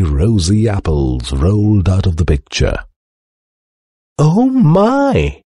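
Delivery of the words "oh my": high-pitched housekeeper's amazement; exclaimed. A narrator's reading. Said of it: "exclaimed Missus Flittersnoop".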